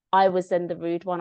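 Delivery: ordinary talk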